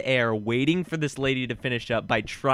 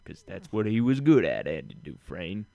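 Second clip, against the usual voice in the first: Morgan Freeman; 6 semitones lower than usual. Acted. Finishing the requested impression for co-host, low narrator. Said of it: "can you do a Morgan Freeman?".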